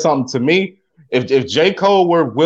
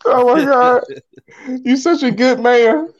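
high, weepy gushing; mock tearful; 8 semitones higher than usual. Co-host swooning at the husband's love message, mock tearful. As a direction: pretend you are tearing up. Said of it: "I'm tearing up".

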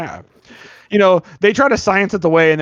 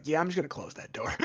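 muttered aside; 15 dB quieter than usual. Retold or imitated. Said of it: "He's like".

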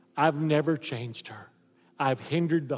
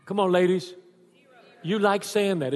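usual speaking voice

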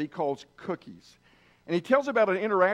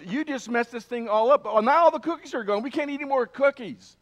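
higher-pitched mock upset complaint; upset Toad; 6 semitones higher, 3 dB louder than usual. Toad scolds Frog over the lost cookies, upset. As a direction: protest in a raised pitch, storybook upset. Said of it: "Toad is upset about that".

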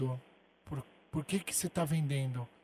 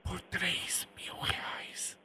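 slow, barely audible whisper; 4 semitones higher, much slower than usual. Pretend. Prank caller naming the price, a slow whisper. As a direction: draw it out in a whisper. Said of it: "prank whisper".

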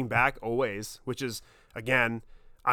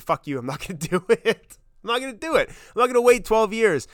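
a defiant refusal, louder and higher by the end, with a laugh; 9 semitones higher, 5 dB louder than usual. Retold or imitated. Imagining telling his younger self to wait twelve years, laughing and defiant. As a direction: brush off the idea with a laughing, defiant no. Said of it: "I probably would have said".

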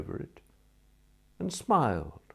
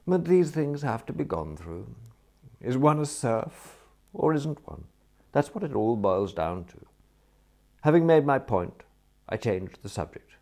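ordinary delivery, nothing marked

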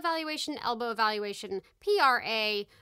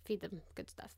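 whispered aside; 4 semitones lower, 14 dB quieter than usual. Real, not acted. Host whispers a quick tip to listeners. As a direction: whisper it off-hand. Muttered aside after reading.

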